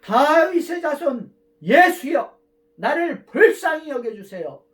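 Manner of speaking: loud, slow, high-pitched cry for mercy; blind man's shout. Retold or imitated. Blind beggar shouting to Jesus for mercy, loud slow cry. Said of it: "소리를 질렀습니다".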